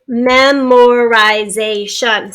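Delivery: memorization slowly, in five separate beats; drawn-out teaching demo; syllable by syllable. Shown as a demonstration. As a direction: break memorization into its parts. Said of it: "a five syllable word".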